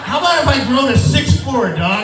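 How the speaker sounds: high, loud, laughing rant; hammed-up indignant shout; mock outrage. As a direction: tear into the doctor with indignation. Comic rant at doctor.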